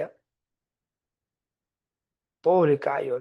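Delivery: ordinary talk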